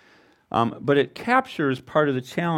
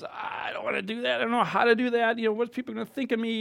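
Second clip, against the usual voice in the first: higher, softer, timid worried voice; fearful inner voice; 8 semitones higher, 5 dB quieter than usual. Retold or imitated. Preacher voicing a believer's fear of witnessing, timid and high. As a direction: voice the nervous excuse of a reluctant witness.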